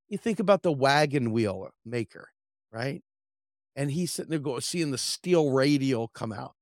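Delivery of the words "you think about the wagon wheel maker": normal talking voice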